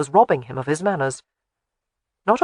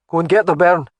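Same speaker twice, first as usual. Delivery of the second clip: a loud, curt command; loud order; 6 dB louder than usual. A narrator's reading. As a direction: order her off loudly. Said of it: "he ordered".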